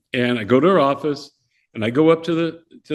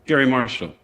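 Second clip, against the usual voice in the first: usual speaking voice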